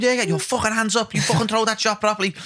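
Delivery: mock coach's telling-off. Imitating the pad holder correcting a fighter's hands and shots, mock telling-off. Acting out coach shouting orders on pads.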